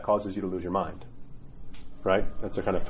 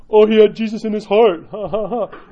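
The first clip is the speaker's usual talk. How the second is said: loud, high jeer ending in fake laughter; mocking soldier; 9 semitones higher, 7 dB louder than usual. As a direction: scoff at the dead man, then ha-ha. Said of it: "they mocked him at the end".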